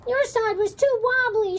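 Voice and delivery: high, loud blaming shout. A narrator's reading. Meg shouts at Simone after the crash. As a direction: yell the blame at her. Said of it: "shouted Meg".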